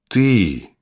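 very slow, drawled realisation; drawn out. Acted. Witch drawls her discovery to the girl. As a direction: stretch every word as it dawns. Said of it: "протянула колдунья".

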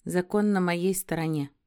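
ordinary delivery, nothing marked

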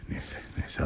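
whispered dismissal. Acted. Father drops the subject.

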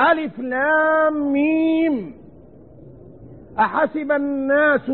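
measured Quran chanting; proper tajweed recitation. Shown as a demonstration. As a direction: stretch each letter as in tarteel. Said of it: "كلام الله لا يقرأ هكذا".

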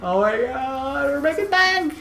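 high, slow giddy squeal; mock excitement. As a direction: gush like you struck it rich.

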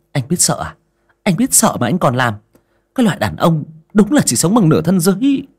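high, sneering contempt; mock him with disdain; scornful mockery. Thủy chế giễu Đại. (khinh bỉ mỉa mai)

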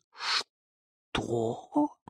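shocked, hushed gasp; low, soft, aghast. A narrator's reading. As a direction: breathe it out in shock. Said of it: "ахнула Бася".

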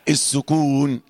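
ordinary delivery

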